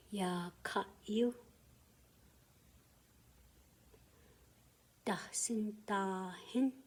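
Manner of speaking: "No hui ta. Ya ka yu." given syllable by syllable with long gaps; slow lead-in for learners to echo; slow call and response. Shown as a demonstration. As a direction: pause after each phrase for the class to repeat. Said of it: "After me, you can say".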